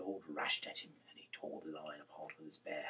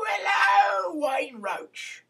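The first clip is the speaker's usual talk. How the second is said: loud greeting; 23 dB louder, much slower than usual. Real, not acted. Loud high greeting opening reading.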